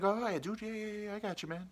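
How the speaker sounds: eager agreement. Retold or imitated. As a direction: act out an obliging driver going along with it. Uber driver eagerly agrees to wait.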